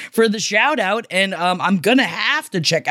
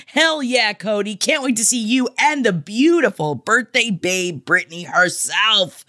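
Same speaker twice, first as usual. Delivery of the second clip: normal talking voice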